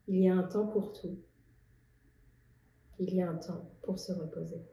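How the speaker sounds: measured poetry reading with long pauses; poem recital. Acted. Poet sharing her poem on rest with viewers, reciting.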